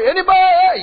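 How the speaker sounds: put-on lost kid calling for grownups; retold yell. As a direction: call out to see if anyone hears. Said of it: "We start".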